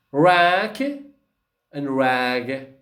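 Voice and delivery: Rack and rag drawn out very slowly; slow demo. Shown as a demonstration.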